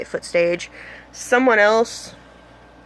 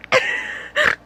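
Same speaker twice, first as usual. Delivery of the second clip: sudden high squeal of shock; starstruck squeal. Retold or imitated. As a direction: let out a high squeal like you're dying inside. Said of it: "that was the ugliest face ever".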